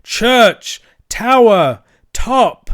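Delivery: high, separated single-word shouts; very slow, loud. A narrator's reading. Cyril replying from the tower, slow and loud. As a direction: shout each word on its own. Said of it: "in his deepest voice, very slow and loud".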